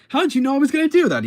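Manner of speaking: shocked squeal of disbelief; startled villain. Retold or imitated. Goblin baffled that his attack was seen coming, high startled shout. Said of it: "then Goblin's like".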